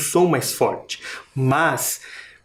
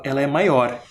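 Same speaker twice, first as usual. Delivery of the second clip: ordinary delivery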